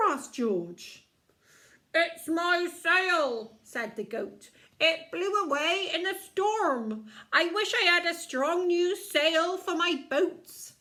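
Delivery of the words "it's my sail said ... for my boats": put-on goat with a Cornish accent; loud, higher Cornish goat. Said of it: "He's a Cornish goat".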